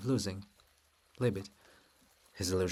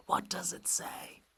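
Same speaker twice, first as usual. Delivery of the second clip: whisper; 4 dB quieter, slower than usual. Acted. Chorus whispers question.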